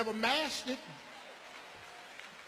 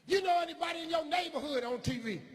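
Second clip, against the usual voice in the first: higher incredulous teacher; 8 semitones higher, faster than usual. Retold or imitated. Teacher cross-examining the boy, high doubting voice.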